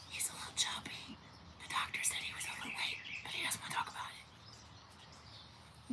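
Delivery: soft unvoiced whisper. Real, not acted. Owner whispering to viewers about her dog's weight, hushed. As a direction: whisper it so the dog won't hear.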